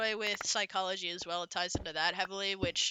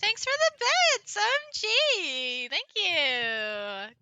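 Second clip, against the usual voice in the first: put-on valley girl accent; 4 dB louder than usual. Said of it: "be redeemed Valley Girl accent".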